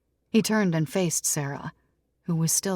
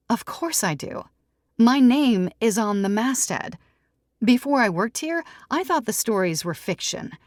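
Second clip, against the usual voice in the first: higher and louder, sincere and open; lightly voiced Sarah, earnest; 5 semitones higher, 4 dB louder than usual. Sarah tells Tom why she believes, earnest and raised. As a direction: explain yourself with conviction. Sarah explains her past.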